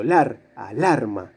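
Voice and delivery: "lar" isolated, then slow "alarma" stressed in the middle; low, measured "lar", then "alarma" leaning on LAR. Shown as a demonstration. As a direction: slowly show the strong LAR in "alarma". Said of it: "la sílaba fuerte es la del medio".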